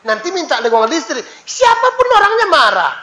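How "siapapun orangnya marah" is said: emphatic exclamation. Real, not acted. Preacher stresses anger.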